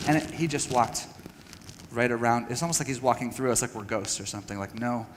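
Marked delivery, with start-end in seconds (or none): none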